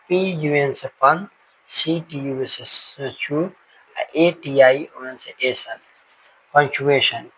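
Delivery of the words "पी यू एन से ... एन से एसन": slow letter-by-letter syllable breakdown; spelling out syllables. Shown as a demonstration. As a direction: spell out each chunk slowly like a teacher at the board. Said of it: "चलिए इसमें भी हम लोग बात करते हैं". (अक्षर-अक्षर धीरे-धीरे बताते हुए)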